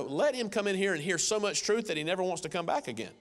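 usual speaking voice

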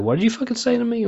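high, fast indignant challenge; hammed-up outraged squawk; mock confrontation. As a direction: act affronted, pitch shooting way up. Acts out confronting the lion.

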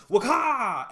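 loud, high mock "Waka!" burst; shouted waka effect. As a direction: bark out "Waka!" like a game sound effect. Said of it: "And then she goes".

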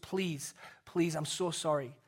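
usual speaking voice

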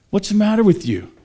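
high, loud rebuke of a fool; acting out a scoffer's exasperation; scolding disbelief. Street mocker to the preacher, scolding disbelief. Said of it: "I've actually had people say".

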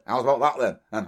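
put-on cuddly old daft DJ catchphrase; Jimmy Savile. Recalling the old radio days, a daft DJ catchphrase impression. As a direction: impersonate a harmless old radio personality. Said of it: "Jimmy Savile, when he was".

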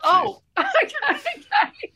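high, laughing stunned okay; overwhelmed laugh. Retold or imitated. Author hearing word count, overwhelmed high laugh. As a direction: laugh it out, swamped by the task. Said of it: "I was so overwhelmed".

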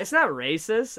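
loud, high and laughing denial; indignant retort. Retold or imitated. Retelling a Grammys argument, laughing loud protest. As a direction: protest it through a laugh, loudly.

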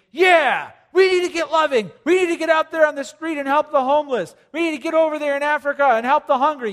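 hammed-up zealous do-gooder; eager zealous churchgoer. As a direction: fire up like an overeager volunteer. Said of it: "Sometimes we think".

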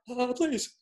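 high-pitched fanboy plea; starstruck pleading. Retold or imitated. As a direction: squeak out an overwhelmed yes. Starstruck fan accepts an invitation.

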